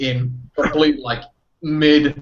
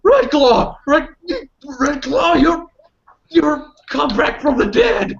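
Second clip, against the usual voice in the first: yelping with joy. Acted. Wolf spirit yelping at his returned packmate, overjoyed. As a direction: yelp it out like an overjoyed dog. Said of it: "just yelping out".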